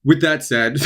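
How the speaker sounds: words breaking into a laugh. Real, not acted. Author cracking up at his own fumble, laughing. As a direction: try to restart but giggle through it. Cracking up after losing track.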